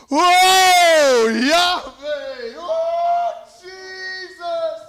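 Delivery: very loud, high, drawn-out cries; ecstatic worship shout. Retold or imitated. Preacher re-enacting worship aloud on the train, ecstatic shout. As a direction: wail it out in full-voiced worship.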